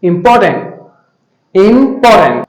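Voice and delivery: इंपॉर्टेंट as im-PAW-tnt, R dropped and ending reduced; correct pronunciation. Shown as a demonstration. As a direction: model इंपॉर्टेंट with a silent R and light ending. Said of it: "इसको इंपॉर्टेंट नहीं पढ़ना है".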